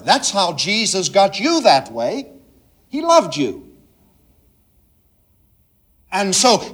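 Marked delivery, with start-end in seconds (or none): none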